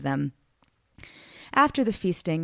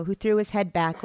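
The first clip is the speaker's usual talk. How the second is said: ordinary delivery, nothing marked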